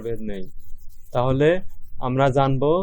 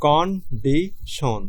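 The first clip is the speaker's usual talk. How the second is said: very slow, syllable-by-syllable condition, con-di-tion; split into syllables; much slower than usual. Shown as a demonstration. Teacher showing students three syllables, very slow split. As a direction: stretch condition out into three separate beats. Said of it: "এই শব্দটা উচ্চারণ হইতেছে, তিনভাবে".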